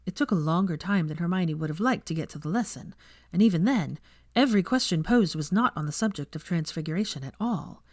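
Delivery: ordinary delivery, nothing marked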